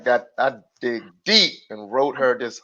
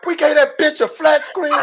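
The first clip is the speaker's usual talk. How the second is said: indignant disbelief; 12 semitones higher, 4 dB louder than usual. Retold or imitated. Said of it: "She said".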